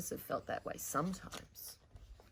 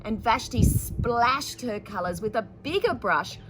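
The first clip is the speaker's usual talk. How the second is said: put-on louder, expressive read-aloud to children; story narration; 6 semitones higher, 9 dB louder, slower than usual.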